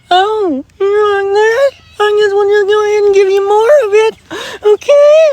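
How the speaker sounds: very high, loud mocking whine; mocking universe. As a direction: sneer back like a sarcastic universe. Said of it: "And the universe is going".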